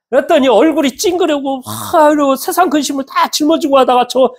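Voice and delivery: usual speaking voice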